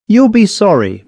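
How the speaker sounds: high, distinct warning; said distinctly. A narrator's reading. Miss Harper warns the bus driver, distinctly. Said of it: "Miss Harper said distinctly".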